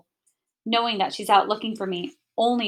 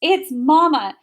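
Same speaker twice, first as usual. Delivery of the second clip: ordinary delivery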